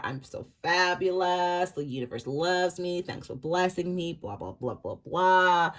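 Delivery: slow, drawn-out mock boasting; mock smug self-praise. A tarot reader mocking a person who acts fabulous. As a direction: send up a self-satisfied show-off. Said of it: "This is how this person is".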